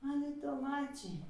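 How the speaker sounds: soft fading vendor call; quiet. Acted. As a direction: let the call die away softly. Barker call fades to quiet.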